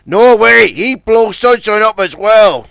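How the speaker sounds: loud, alarmed objection; horrified protest. Acted. Big Mac protests the dynamite plan, loud. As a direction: refuse in alarm.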